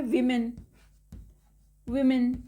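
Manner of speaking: विमेन with "wi" as first syllable; short "i" opening on विमेन, then "men"; plural pronunciation. Shown as a demonstration. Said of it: "plural होता है, इसे पढ़ते हैं".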